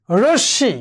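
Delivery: রশ্মি said as rosh-shi, with a double sh; careful demonstration of doubled sh in রশ্মি; doubled consonant. Shown as a demonstration. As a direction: hold the sh in রশ্মি, no m. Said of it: "দ্বিত্ব হয়েছে।".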